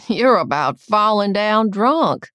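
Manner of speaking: loud, slow drawn-out remark; slow, emphatic aunt's chiding. A narrator's reading. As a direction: loudly tease someone for being drunk. Aunt scolding tipsy girl in story.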